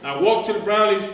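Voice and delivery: ordinary delivery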